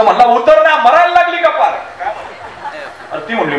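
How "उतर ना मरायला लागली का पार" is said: high-pitched impatient snap; snapping angrily. Acted. Impatient husband snaps at old woman blocking the bus, angry. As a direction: scold impatiently, pitched well up. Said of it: "त्या म्हातारीवर हे खेकसलं".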